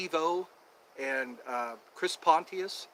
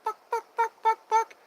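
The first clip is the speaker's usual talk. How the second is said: high, loud clucking "buck buck"; mock chicken clucking, pitched way up; 7 dB louder than usual. Shown as a demonstration. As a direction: cluck like a chicken to set the dog off. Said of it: "I used to do a chicken cluck".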